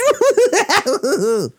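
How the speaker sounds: high manic cackle; Joker cackle. Retold or imitated. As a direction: cackle like a villain. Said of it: "Something like that".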